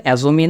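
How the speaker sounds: "assume" turned into "assu-mee"; mispronunciation. Shown as a demonstration. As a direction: tack an ee onto the end of "assume". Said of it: "না, এটা একসাথে". ("assume"-কে ভুল করে "অ্যাজুমি" বলা)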